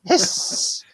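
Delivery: breathy hammed-up hiss; mock creature hiss. [name] turning into Abraxas, hissing. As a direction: hiss like a snake demon.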